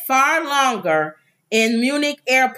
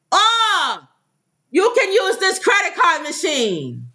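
mock helpful officer; 7 semitones higher than usual. Retold officer quote.

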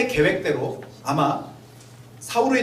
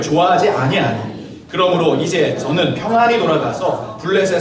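slow, solemn Bible reading voice; scripture reading; 2 semitones lower, 5 dB louder than usual. Acted. Pastor reading scripture to the congregation, slow and solemn. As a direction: read each verse out deliberately, like from the pulpit. Reads the chapter aloud.

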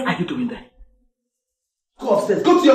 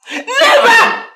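high, loud shouted never; shouted refusal; 11 semitones higher, 7 dB louder than usual. Acted. Mother shouting down Dennis's love for Kemi. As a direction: bellow the refusal.

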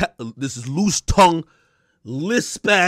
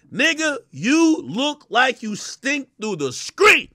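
slow taunting; 5 semitones higher, slower than usual. Real, not acted. Insult, drawn out.

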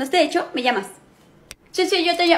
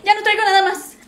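high, frantic pleading with a nervous laugh; hammed-up fright; panicked victim; 7 semitones higher than usual. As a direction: hand it all over in a fluster. Victoria being robbed.

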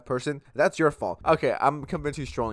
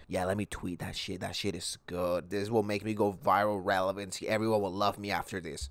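mock smug tweeter; 6 semitones lower, 6 dB quieter than usual. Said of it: "you're like".

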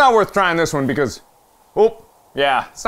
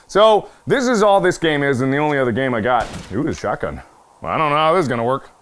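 usual speaking voice

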